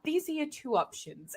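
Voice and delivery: a hint of steady patient friend; calm Mike. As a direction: talk him down gently. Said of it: "Mike's like".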